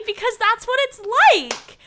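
high, loud, laughing. Real, not acted. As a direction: laugh through the words. Laughing about over the hill.

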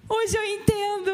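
admitting it through tears; tearful confession. Retold or imitated. Woman crying to her pastor, slow tearful admission. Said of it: "Chegou pra mim chorando".

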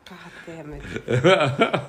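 drawn-out amused exasperation; laughing groan. Real, not acted.

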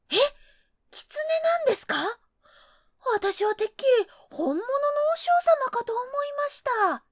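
high, fast, astonished; surprised fox voice. Acted. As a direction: gasp out the surprise.

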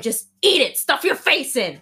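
hammed-up bossy urging; goading. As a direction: push it hard, building to a shout. Depression goads Maya to binge.

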